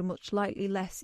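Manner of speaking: usual speaking voice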